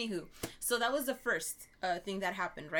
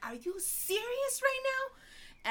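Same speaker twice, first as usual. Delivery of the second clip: slow, high, disbelieving "are you serious"; incredulous; slower than usual. Retold or imitated. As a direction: stare him down and drag it out in disbelief. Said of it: "I'm like".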